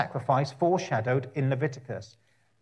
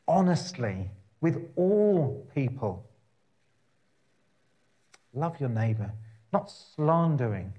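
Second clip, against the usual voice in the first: slow, deliberate paraphrase with long pauses; slowly. Shown as a demonstration. Preacher paraphrasing the law for his listeners, slow and deliberate. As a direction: lay each phrase down slowly so it sinks in. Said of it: "I'll say it slowly".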